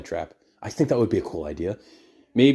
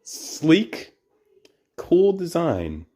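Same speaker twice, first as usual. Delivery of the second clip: sarcastic; 6 semitones higher, much slower than usual. Acted. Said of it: "only one of those words applies".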